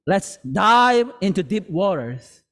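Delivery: usual speaking voice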